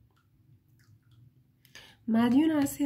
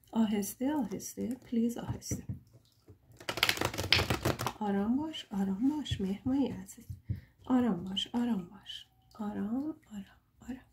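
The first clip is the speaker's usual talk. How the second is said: hushed, calming, fading toward a whisper; soft soothing; 3 semitones lower, 8 dB quieter, faster than usual. Real, not acted. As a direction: gently settle them down in a soft voice. Soothing the listeners down.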